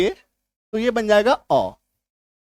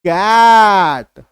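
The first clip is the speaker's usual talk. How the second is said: कैट loud and greatly stretched; कैट drawn out slowly with extra force; 5 dB louder, much slower than usual. Shown as a demonstration. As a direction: push hard on the open "ae" in कैट. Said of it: "उच्चारण थोड़ा जोर से करते हैं".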